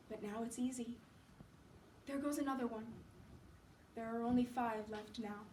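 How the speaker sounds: soft, listless invalid; weak Johnsy. Acted. Sick Johnsy watching leaves fall, faint and resigned. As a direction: speak faintly, with little energy left.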